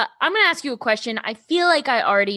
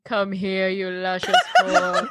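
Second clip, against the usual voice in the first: imitating deep voice; 6 semitones lower than usual. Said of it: "I want my ringtone to be".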